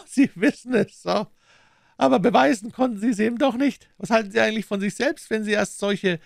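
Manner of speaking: high, loud, taunting voice; scornful villain Smith. A narrator's reading. Smith berates dying Holmes, scornful high voice.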